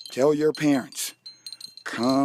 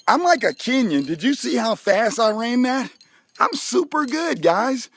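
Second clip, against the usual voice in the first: excited bragging; 9 semitones higher, 4 dB louder than usual. Real, not acted. Bragging after the run.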